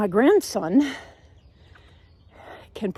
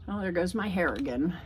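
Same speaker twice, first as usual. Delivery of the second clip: normal talking voice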